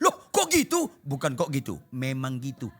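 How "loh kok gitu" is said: seruan kaget bernada sangat tinggi. Retold or imitated. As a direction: imitate a startled listener, pitch leaping way up. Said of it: "Dia kaget". (kaget, nada melonjak tinggi)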